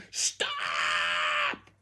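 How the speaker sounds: long, wailing storybook yell; loud yell. A narrator's reading. Girl lifted by the ear, yelling as loud as possible. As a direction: hold the vowel in one big yell. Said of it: "yelled as loud as she could".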